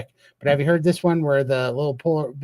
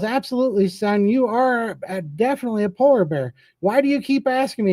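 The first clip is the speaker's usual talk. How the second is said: hammed-up dad, pitched up; dad polar bear; 8 semitones higher than usual. Dad bear reassuring his son, a bit exasperated, voiced high. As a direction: play the patient dad humouring the cub. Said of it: "He goes".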